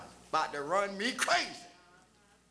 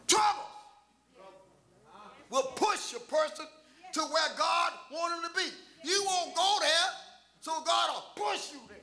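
full-force preaching; 5 semitones higher than usual. Real, not acted. Preacher telling the church that trouble will push, forceful proclaiming. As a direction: proclaim it in weighty, separated phrases.

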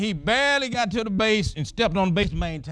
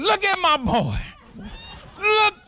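proud excited; 12 semitones higher than usual. Retold or imitated. Father in the stands cheering his son, excited. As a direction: point him out, bursting with pride. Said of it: "I said".

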